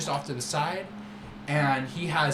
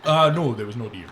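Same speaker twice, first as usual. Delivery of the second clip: louder, sweeping showman voice; grand merchant boast; 4 dB louder than usual. Acted. Merchant Syrah boasting to the young friend, loud and showy. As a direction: boast like a flamboyant trader.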